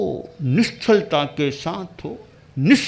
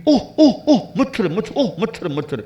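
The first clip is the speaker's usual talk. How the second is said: flustered yelps; 5 semitones higher, faster than usual. Acted. Guru bitten by a mosquito, high flustered yelps. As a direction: flap about like a startled man.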